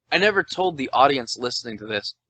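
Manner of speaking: ordinary talk, nothing marked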